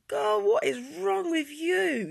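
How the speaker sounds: high, loud, drawn-out exasperation; exasperated disbelief. Retold or imitated. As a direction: stretch it out in acted bafflement at a friend. Acting out baffled thought at friend.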